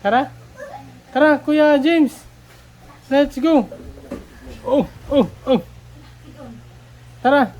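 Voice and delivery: loud call down to breakfast; calling from far away. Real, not acted. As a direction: call everyone to come eat. Calling everyone down to eat.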